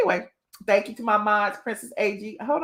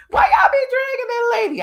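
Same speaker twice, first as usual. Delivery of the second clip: loud, high-pitched mock scolding; mock outrage; 7 dB louder than usual. Streamer scolding chat for dragging a lady, mock outrage. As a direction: cry it out in playful protest.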